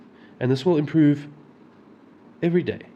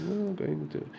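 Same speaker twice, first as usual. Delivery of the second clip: soft daydreaming murmur, pitched up; dreamy, carefree; 6 semitones higher, 10 dB quieter, slower than usual. Retold or imitated. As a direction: drift along absently, barely audible. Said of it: "not a care in the world, you know, like, daydreaming".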